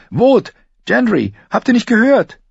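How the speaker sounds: high, loud urgent question; girl crying out in alarm; alarmed calling. A narrator's reading. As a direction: call out to rouse the sleepers. Rousing the others in alarm.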